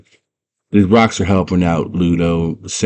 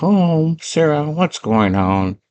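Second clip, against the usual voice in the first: a hint of a higher knight voice, slowing at the end; raised a little, then drawn out; lightly voiced knight; 8 semitones higher, much slower than usual. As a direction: knight asks Sarah what's up. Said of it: "And Sir Vitamus is like".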